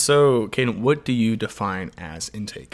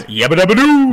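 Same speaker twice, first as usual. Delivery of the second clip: high, loud, laughing cartoon cry of Yabba dabba doo; Fred Flintstone impression; 9 semitones higher, 9 dB louder than usual. Picking a cereal mascot, host does a laughing cartoon caveman yell. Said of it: "none of those have accents".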